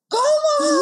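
very high, loud "¿Cómo?"; outraged disbelief; imitating father's shocked yelp; retold shocked dad. Dad shocked she hesitated.

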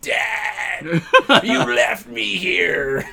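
loud, drawn-out wail to Dad; high, slow, hammed-up cry of abandonment; mock anguished cry. Abandoned offspring calls to Dad from the drain, mock anguished cry.

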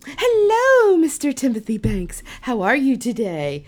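hello shot up high and loud, then overly formal; nervous fool greeting. Retold or imitated. Flustered masseuse greeting her crush, a nervous high hello. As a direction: gush the greeting like a flustered fan. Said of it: "So like a fool I am".

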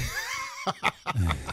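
real high-pitched burst of laughter; high, giddy laughing. Real, not acted. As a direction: crack up at your own quip. Laughs at own quip.

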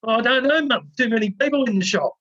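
woozy and confused; dazed boy. Retold or imitated.

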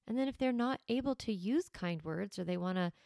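ordinary delivery

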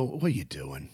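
low, soft grumble of a disapproving dad; grumbling dad. Retold or imitated. Son imitating his father scoffing at the junk, low grumble. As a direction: mutter it like a dad judging the clutter. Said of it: "he would go".

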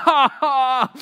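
loud, high-pitched exaggerated fake laugh; mock dying laughing. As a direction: overdo laughing at someone's joke. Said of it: "Like, dying laughing".

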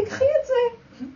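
high, slow, tearful tempting voice; tempting inner voice. Retold or imitated. Her inner voice tempts her to take the phone, high and tearful. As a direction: voice the temptation through tears. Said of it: "איזה מחשבה הייתה לי".

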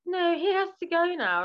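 a light send-up of a firm midwife; imitating midwife. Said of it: "And they were like".

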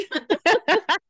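squeals with high laughter; high laughing squeal. Real, not acted. Co-host cracking up at the accent joke, high squealing laugh. Reacts to the Minnesota bit.

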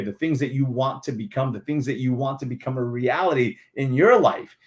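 ordinary delivery

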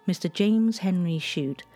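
ordinary delivery

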